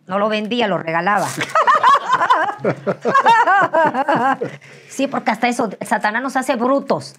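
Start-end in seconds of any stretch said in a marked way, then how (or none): 1.3-4.8 long, high, loud cackle; big laugh. Real, not acted. Confessing she gave it away, a loud high laugh. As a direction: burst out in prolonged loud laughter.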